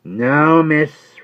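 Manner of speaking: high, loud rough servant voice; Jerry Cruncher. Acted. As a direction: answer her like a loyal, plain-spoken servant. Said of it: "returned Jerry".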